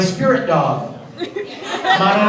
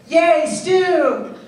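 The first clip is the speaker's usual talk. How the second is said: put-on high, cheery little-boy cheer; cheerful kid voice; 9 semitones higher than usual. Al cheering about stew, high kid voice. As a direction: chirp it like an excited kid brother. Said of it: "Al turns around and goes".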